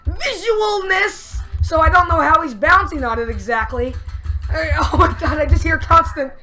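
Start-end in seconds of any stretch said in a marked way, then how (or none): none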